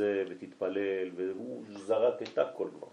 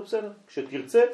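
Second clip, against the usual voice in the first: eager reassurance; 10 semitones higher, 6 dB louder than usual. Retold or imitated. Reassuring boy he can call.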